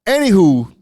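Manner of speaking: ordinary talk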